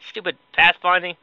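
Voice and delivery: ordinary talk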